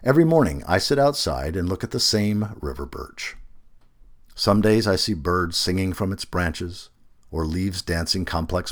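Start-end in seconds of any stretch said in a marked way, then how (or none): none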